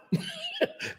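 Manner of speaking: a quick high giggle, breathy and short; high light laugh. Real, not acted. Guest laughs at his own brain-to-mouth joke. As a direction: laugh at your own point.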